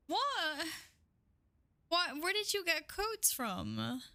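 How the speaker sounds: high surprised what. Real, not acted. Surprised by free codes.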